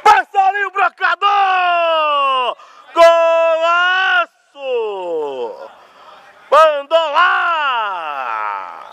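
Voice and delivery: long stretched stadium yell; drawn-out goal shout. Real, not acted. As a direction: hold every word like a goal cry.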